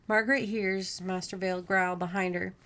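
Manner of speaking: ordinary delivery, nothing marked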